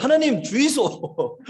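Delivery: high, slow Gyeongsang dialect plea. Retold or imitated. Missionary imitating prayer heard from behind, Gyeongsang dialect. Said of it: "경상도 사투리로 기도하는 소리가".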